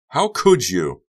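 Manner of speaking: loud, high outraged cry; angry accusation. A narrator's reading. Becca to a bewildered Honey, angry accusation.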